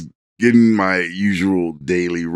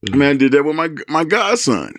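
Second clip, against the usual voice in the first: normal talking voice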